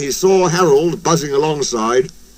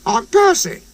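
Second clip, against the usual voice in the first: little engine voice; 8 semitones higher than usual. A narrator's reading. Percy introduces himself.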